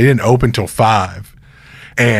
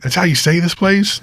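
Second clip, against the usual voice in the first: retold surprise; 7 semitones higher than usual.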